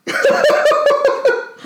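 a burst of high, loud laughter. Real, not acted. Laughing after the reveal.

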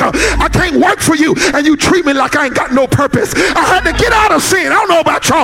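a pastor driving the message home to the congregation; full-force preaching. Acted.

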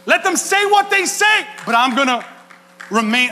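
high, loud proclaiming; preaching shout. Real, not acted. Pastor shouting to his congregation, full force. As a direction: shout it out to the church.

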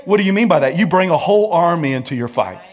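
ordinary delivery

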